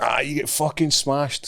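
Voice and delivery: a hint of mock Chechnyan accent; fake Chechnyan accent. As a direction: imitate a prank caller's fake Chechnyan threat. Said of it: "like, a Chechnyan voice".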